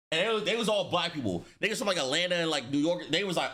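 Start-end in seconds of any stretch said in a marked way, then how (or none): none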